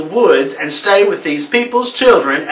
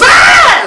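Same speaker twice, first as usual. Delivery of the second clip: very high, loud scream; high, loud terrified scream; shrill scream; 7 dB louder than usual. Acted. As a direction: shriek and slam the door. Girl sees witch again.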